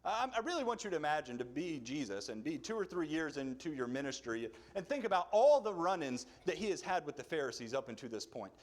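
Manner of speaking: usual speaking voice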